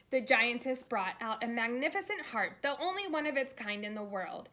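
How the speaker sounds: ordinary delivery, nothing marked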